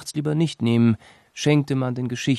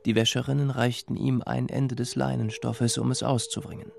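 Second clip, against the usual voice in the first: ordinary delivery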